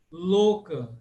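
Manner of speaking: loka with a closed O; slow 'LOH-ka' for loka; closed 'o' vowel in loka. Shown as a demonstration. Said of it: "só tem o O fechado".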